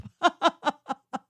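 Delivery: short giggle falling off; high laugh. Real, not acted. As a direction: laugh at the joke. Laugh after joke.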